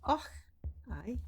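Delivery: short put-on Scottish exclamation; fake Scottish accent. Streamer playing a game hero, fake Scottish accent. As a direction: toss it off in mock Scots.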